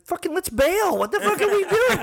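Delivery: high, loud-pitched urging to flee; excited, high-voiced comic alarm; retold panic. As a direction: push the voice way up, urge a getaway. Acts out urging an escape.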